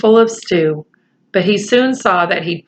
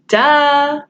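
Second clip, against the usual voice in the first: high, dry eye-roll duh; mocking duh; sarcastic duh; 6 semitones higher than usual. Real, not acted. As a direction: scoff it like it's obvious. Reader's sarcastic aside.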